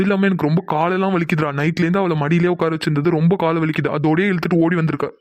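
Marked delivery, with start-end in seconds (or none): none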